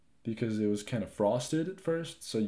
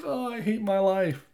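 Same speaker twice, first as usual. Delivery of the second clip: mock sulking; 8 semitones higher than usual. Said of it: "Don't sulk up and be like".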